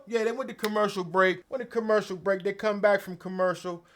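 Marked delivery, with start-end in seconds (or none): none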